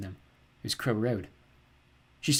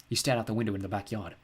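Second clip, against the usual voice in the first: plain reading voice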